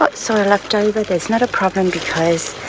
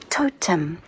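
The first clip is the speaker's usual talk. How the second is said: usual speaking voice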